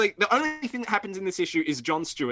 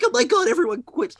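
higher, louder mock exclamation; mock stupid people; 9 semitones higher, 5 dB louder than usual. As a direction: imitate a dim complainer, pitched up and loud. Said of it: "when really stupid people go".

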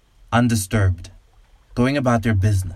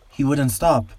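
higher, scared man's plea; frightened man; 5 semitones higher than usual. A narrator's reading. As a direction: plead it, shaken and afraid. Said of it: "whispers the man".

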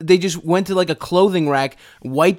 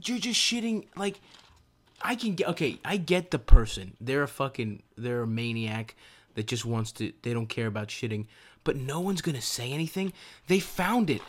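hushed rant; 3 semitones lower, 9 dB quieter than usual. Real, not acted. Lowering voice for sleeping daughter.

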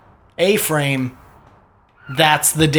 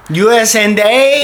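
mock surprise; 6 semitones higher, 5 dB louder than usual. Mocking repeat of phrase.

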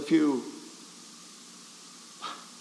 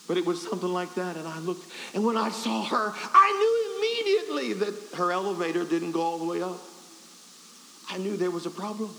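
normal talking voice

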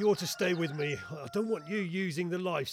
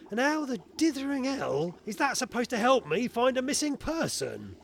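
raised, high-pitched indignation; exasperated outburst; 7 semitones higher, 4 dB louder than usual. Acted. Inspector challenges inventor about the missing person case, exasperated. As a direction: demand an answer, voice climbing in exasperation.

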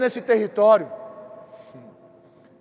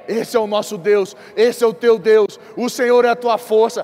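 louder proclamation, building to the end; preaching climax; 5 dB louder than usual. Acted. Pastor proclaims God's strength to the church, loud. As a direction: declare it to the whole church.

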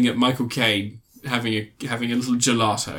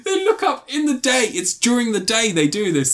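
high, laughing disbelief; incredulous laughter; 8 semitones higher than usual. Real, not acted. Reviewer pointing out the daylight error, laughing incredulously. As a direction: insist through bursts of laughter.

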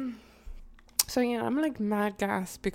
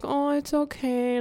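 high, slow, drawn-out soothing; mock reassurance; 6 semitones higher, 4 dB louder than usual. Empathetic girlfriend excusing his being broke, mock-soothing. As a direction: imitate your own overly forgiving self. Mocks her own indulgent reassurance.

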